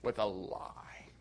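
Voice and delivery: solemn near-whisper; grave. Acted. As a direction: let it sink in, heavy and slow. Grave repeated point.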